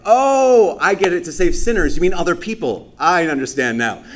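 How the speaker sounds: loud, high mock aha; mock realization. A churchgoer mockingly thinking it means others, loud fake aha. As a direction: overplay the sudden insight.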